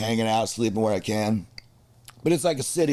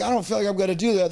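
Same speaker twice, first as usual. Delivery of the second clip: nervous refusal; 9 semitones higher, faster than usual. Retold or imitated. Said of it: "And I was like".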